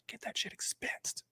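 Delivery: whispered under breath, unvoiced; a conspiratorial whisper; whispered aside. Real, not acted. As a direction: slip in a sly whisper. Aside muttered.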